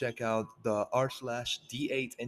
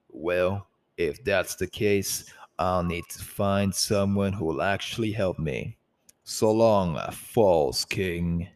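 taunting rebel voice; 4 semitones lower, 8 dB louder, slower than usual. Acted. Ignis taunts Tenebris.

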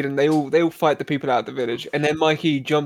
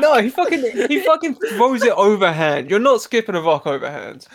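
indignant; 6 semitones higher than usual. Real, not acted. Heated argument about skipping rocks.

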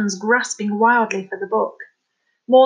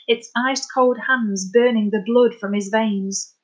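ordinary delivery

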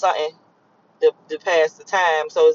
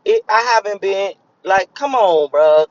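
ordinary talk

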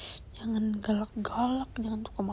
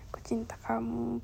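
soft intimate whisper into the mic; 7 dB quieter than usual. Real, not acted. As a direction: whisper it close, like a secret. Whispers to listener throughout. (berbisik lembut)

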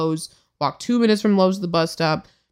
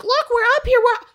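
mocking kids; faster than usual. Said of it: "They're like".

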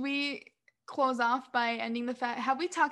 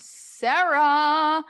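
drawn-out announcement; 4 semitones higher, 10 dB louder than usual. Real, not acted. Announcing the award winner.

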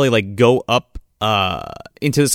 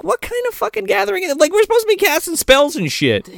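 fast, squeaky, much higher voice; high mocking voice; faster than usual. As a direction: pitch way up and rush it, mocking. Speaker mocks his own reaction.

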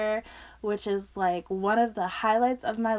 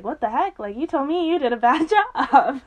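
raised, louder protest ending in laughter; mock disbelief; 6 semitones higher, 5 dB louder than usual. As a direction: call out a friend, laughing at the end. Acts out best friend's disbelief.

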